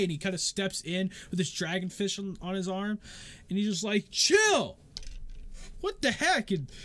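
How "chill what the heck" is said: high, loud, incredulous yell; exasperated shout. Acted. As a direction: shout them down in disbelief. Joseph stops the fighters.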